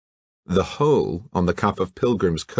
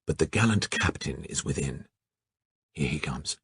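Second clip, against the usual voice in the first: soft hushed aside; whispering; 4 semitones lower, 8 dB quieter than usual. Acted. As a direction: keep it hushed, he's coming. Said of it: "whispered Alain".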